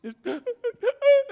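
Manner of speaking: high, loud gasping sob; choking sob imitation. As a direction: imitate a kid's choking cry. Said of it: "Like that choking, can't breathe cry".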